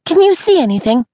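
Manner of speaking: put-on high, loud eager girl; bouncing with impatience. Daisy's eager question.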